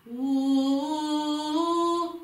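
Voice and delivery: high, loud "ooh" held with a tight throat; strained, narrow held hoot; tense, squeezed "ooh". Shown as a demonstration. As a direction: demonstrate a constricted sung "ooh". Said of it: "comme si c'est un peu serré".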